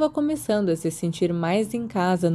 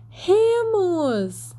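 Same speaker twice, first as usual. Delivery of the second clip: high excited cry of his name; shouted name; 11 semitones higher than usual. A narrator's reading. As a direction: call out his name in happy surprise. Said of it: "Todas elas gritaram".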